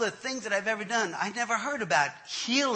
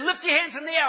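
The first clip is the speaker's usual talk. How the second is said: high, loud revival order; imitated preacher command; 8 semitones higher, 5 dB louder, faster than usual. Pastor ordering man to prepare for prayer, loud command.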